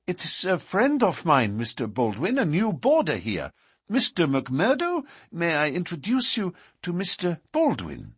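high-pitched woman's voice, nervously polite; flustered young woman. A narrator's reading. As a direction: make an anxious, high introduction. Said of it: "full of confusion and alarm".

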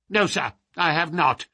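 put-on high, testy old man; quick, indignant old man's rebuff; querulous old lord, dismissive. A narrator's reading. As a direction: refuse flatly in a crotchety old voice.

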